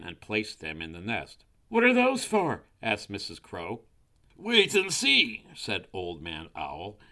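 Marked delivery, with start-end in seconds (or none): 1.7-2.6 high, loud demanding question; crow wife. A narrator's reading. 4.4-5.4 high, loud, slow owl; owl character. A narrator's reading. Owl's mysterious answer to crow.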